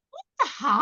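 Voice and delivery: high, astonished outburst; shocked disbelief. Retold or imitated. Mother to the Uber driver, shocked and high. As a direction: let it fly up in shock.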